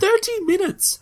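high-pitched disbelieving echo; incredulous. Real, not acted. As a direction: repeat it in outraged disbelief. Incredulous echo of the time given.

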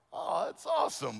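put-on high-pitched gushing "aww" to a kid; touched proud dad. Dad praising his little boy for offering to pay for lunch, high and touched.

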